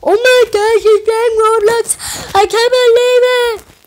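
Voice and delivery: high, loud squealing disbelief; shocked viewer voice. Retold or imitated. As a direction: squeal it like a stunned fan. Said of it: "You guys are probably like".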